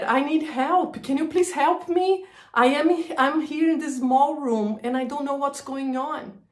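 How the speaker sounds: replaying her own scared cry for help; panicked plea. On the phone begging her friend for help, frightened and pleading.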